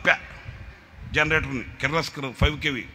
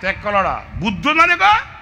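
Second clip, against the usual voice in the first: much higher, louder furious shouting; angry scolding; 14 semitones higher, 8 dB louder than usual. Retold or imitated. Scolding the friend who can't start the generator, furious shout. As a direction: tear into him, scolding hard. కోపం వచ్చి తిట్టా.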